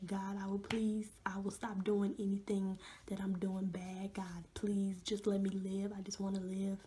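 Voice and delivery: ordinary delivery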